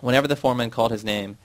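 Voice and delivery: usual speaking voice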